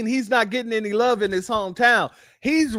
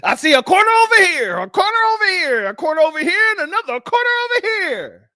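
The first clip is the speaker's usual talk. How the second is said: higher, louder, rushed hype-man call; 7 semitones higher, 4 dB louder, faster than usual. Retold or imitated. Mocking the rapper ordering a mosh pit, hammed-up hype call. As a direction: play the rapper barking orders at the crowd, hammed up. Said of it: "trying to order people to do a goddamn mosh pit".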